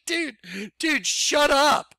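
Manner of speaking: high, slow mocking put-down; exasperated dismissal. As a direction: drag it out, pitched high. Retorting to presumptuous letter writers.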